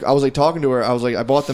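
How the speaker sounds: ordinary talk, nothing marked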